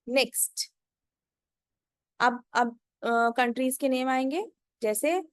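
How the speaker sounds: usual speaking voice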